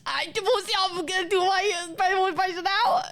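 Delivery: high-pitched mushed-up imitation; mock garbled speech. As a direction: slur the words into comic near-gibberish, pitched high. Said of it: "She did not actually say any words in that sentence".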